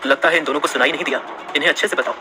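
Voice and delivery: low, quick menacing order; low cold command to his man; cold boss. A narrator's reading. Saksham threatening the frightened girls.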